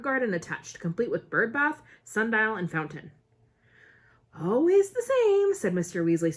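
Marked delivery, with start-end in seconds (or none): 4.4-5.5 slow, higher and louder with a smile; amused at wizards showing off, slowed and lifted; smiling. A narrator's reading. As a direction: savour it fondly.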